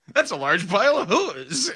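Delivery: high, breezy mock player chat; hammed-up casual banter; imitating players chatting. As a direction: act out two players joking. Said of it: "how they referred to the convention".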